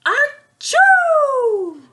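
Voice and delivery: huge high loud sneeze; big sneeze. Acted. Flea sneezing on the hog, a huge high sneeze. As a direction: let the sneeze explode.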